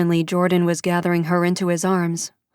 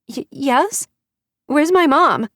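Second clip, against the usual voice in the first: put-on high, loud frightened little girl; a child asking for her mom; higher child's voice, frightened; scared child; 9 semitones higher, 4 dB louder, slower than usual. Child voice on phone.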